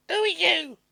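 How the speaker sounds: high, loud wary woman at a crack in the door; woman's voice. A narrator's reading. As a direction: voice her high and suspicious. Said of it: "the woman asked the stranger".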